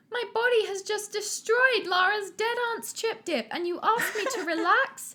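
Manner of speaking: strained voice pitched way high; croaking an octave up. Acted. Clumsy Paul answering Julie's advice, strained high croak. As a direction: squeeze it out high and panicked. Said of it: "Paul croaked, his voice at least an octave above normal".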